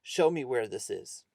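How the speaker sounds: loud, slow, stern order; angry. A narrator's reading. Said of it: "he was really angry".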